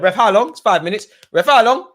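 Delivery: loud, high call to the referee; shouted demand. Real, not acted. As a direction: call out impatiently to the ref. Calls out to referee.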